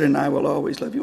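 a father's low, quick reassurance; tender father. Retold or imitated. Father's tender line, then echoed.

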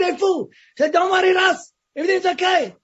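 answering a friend's shout from inside a dark cell; shouting back. Retold or imitated. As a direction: yell the reassurance back out. Shouting back to mate outside.

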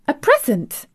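high-pitched astonished question; startled, pitch leaping up; surprised exclamation. A narrator's reading. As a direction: exclaim it in disbelief. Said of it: "exclaimed Mistress Mary".

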